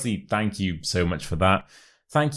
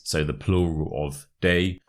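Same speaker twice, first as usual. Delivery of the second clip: normal talking voice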